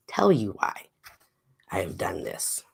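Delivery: low conspiratorial whisper. Real, not acted. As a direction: let them in on a secret. Whispered secret to viewers.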